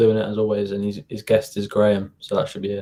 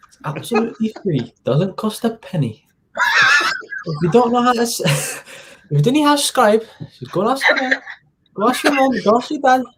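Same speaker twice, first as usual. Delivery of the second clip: put-on Sunderland accent; 10 semitones higher than usual. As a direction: lay the Sunderland accent on thick. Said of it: "back to [name] in his Northeast, uh, Sunderland accent".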